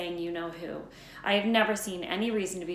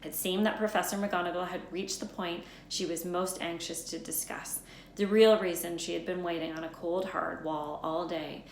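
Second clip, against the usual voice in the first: ordinary delivery, nothing marked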